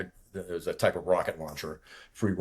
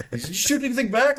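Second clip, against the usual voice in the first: high, loud, eager questioning voice; excited English kid; 5 dB louder than usual. Retold or imitated. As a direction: imitate a wide-eyed young refueler stammering with curiosity. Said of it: "English kid who was a naturalized American".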